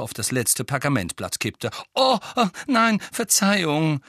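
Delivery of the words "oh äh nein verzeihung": high, loud startled sorry; flustered apology. Acted. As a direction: blurt out a clumsy apology. Tonks spills a candle.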